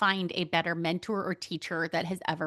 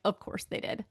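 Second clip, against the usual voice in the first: wry whispered aside; 6 dB quieter than usual. Real, not acted. Wry aside about the butt dial.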